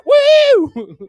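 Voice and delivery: high, loud woo; wild party whoop; whooping cheer. Real, not acted. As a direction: cheer the room on. Crowd cheer.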